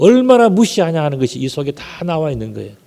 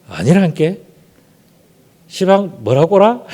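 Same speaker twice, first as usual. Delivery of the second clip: Jeolla accent, slowing into a raised question; Jeolla dialect; 3 semitones higher, slower than usual. Shown as a demonstration. Preacher shows congregation how Jeolla folk deny, Jeolla accent. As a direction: challenge it like a Jeolla local. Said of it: "전라도 말은요".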